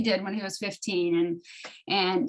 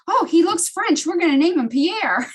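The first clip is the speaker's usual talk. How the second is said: higher, louder delighted announcement; excited exclamation; 9 semitones higher, 7 dB louder than usual. Retold or imitated. Telling Scott the new kitten's name, excited exclamation. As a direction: re-enact a gleeful decision, pitched up and loud.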